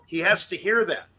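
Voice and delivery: usual speaking voice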